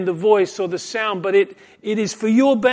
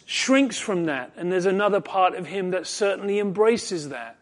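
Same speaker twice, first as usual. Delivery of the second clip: usual speaking voice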